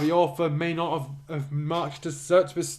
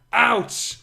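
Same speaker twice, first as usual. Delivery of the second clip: sharp, higher, louder cry of pain; pained yelp; 7 semitones higher, 5 dB louder than usual. Acted. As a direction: yelp like you've just been stabbed. Stabbed character cries out.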